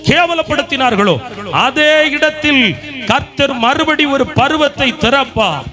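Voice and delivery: high, sustained revival-sermon cry; full-force preaching. Real, not acted. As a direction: proclaim each promise to the crowd in ringing phrases.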